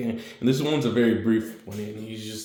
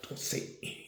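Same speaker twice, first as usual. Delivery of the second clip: whisper; 14 dB quieter, much faster than usual. Acted. Subtitle warning in flashback.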